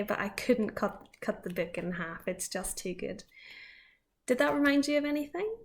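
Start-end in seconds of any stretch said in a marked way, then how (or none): none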